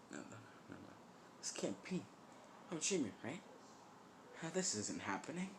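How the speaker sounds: high, quiet disbelief; soft, frantic self-reassurance; panicked denial. Acted. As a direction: talk yourself out of it, high and unsteady in pitch. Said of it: "kinda becoming completely insane".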